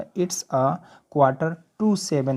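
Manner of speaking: slow, careful dictation pace; slow model sentence. Shown as a demonstration. Teacher modelling the English sentence for students, slow and deliberate. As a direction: spell the sentence out slowly for learners. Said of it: "बोलेंगे".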